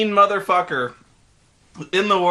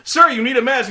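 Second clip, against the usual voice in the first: mock soldier warning; 7 semitones higher, 5 dB louder than usual. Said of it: "he's like".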